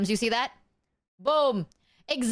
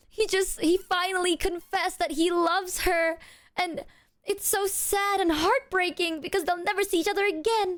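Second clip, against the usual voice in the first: emotional gushing; 7 semitones higher than usual. Real, not acted. Gushing about the heartbreaking ending.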